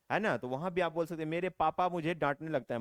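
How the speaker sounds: usual speaking voice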